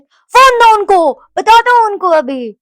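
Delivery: imitating dad, high, loud and slow; hammed-up angry demand, each word drawn out; protective outrage. Possessive papa demanding the phone after daughter's scolding, imitated outrage. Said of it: "मेरे पापा काफ़ी possessive है".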